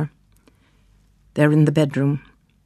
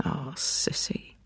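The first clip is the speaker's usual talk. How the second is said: soft unvoiced comfort; whisper; 13 dB quieter, slower than usual. Husband soothing his grieving wife in a whisper. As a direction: breathe her name gently, slow.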